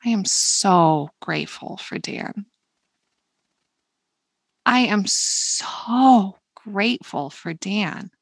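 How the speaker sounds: slowing down, words stretched and meant; slow and intentional thanks; deliberate, slow. Shown as a demonstration. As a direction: linger on each word with intention. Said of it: "deliberate, slow".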